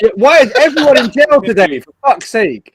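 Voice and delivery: high, loud complaint; exasperated outburst. Real, not acted. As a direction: cry out in fed-up disbelief. Host fed up with bad connections.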